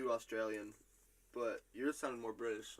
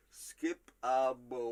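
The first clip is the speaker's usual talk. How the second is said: slow quote as written; 5 dB louder, much slower than usual. Shown as a demonstration. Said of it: "how I have it written down is".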